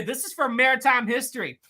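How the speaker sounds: ordinary delivery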